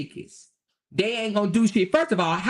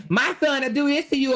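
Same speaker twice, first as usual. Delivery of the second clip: higher, bragging mock mother; 7 semitones higher than usual. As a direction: parody a boastful mom. Mocking boastful mother.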